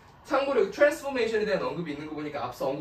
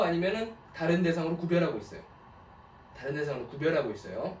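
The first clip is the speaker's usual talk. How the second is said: ordinary talk, nothing marked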